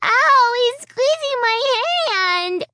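put-on loud high yelp then high child's complaint; a little girl crying out as her hand is squeezed; child in pain. A narrator's reading. Little Alicia crying to her mother about the squeeze.

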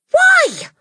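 put-on high, loud child's plea; crying out. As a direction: cry it out desperately. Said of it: "Matilda cried".

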